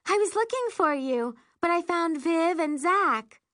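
lightly voiced girlfriend. A narrator's reading. Tammy voiced by narrator.

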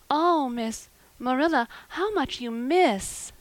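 high, slow, heartfelt exclamation; pitying amazement. A narrator's reading. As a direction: marvel sorrowfully, stretching the words. Anne pities unimaginative Marilla.

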